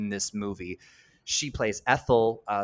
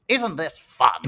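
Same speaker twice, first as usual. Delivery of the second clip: a hint of the high old lady voice; chirpy old lady, cheering everyone up; 5 semitones higher, 5 dB louder, slower than usual. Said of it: "in the only way that Ethel can".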